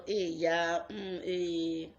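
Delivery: scoffing hey yeah, brushing someone off; mock the struggler with a dismissive hum; dismissive mockery. People mocking her struggle with dismissive scoffing. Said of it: "Once I hear that word".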